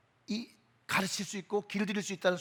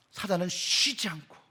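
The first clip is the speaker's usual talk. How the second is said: ordinary talk, nothing marked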